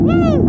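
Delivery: very high, loud joyful whoop; excited whoop. Real, not acted. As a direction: scream with delight downhill. Whoop starting the descent.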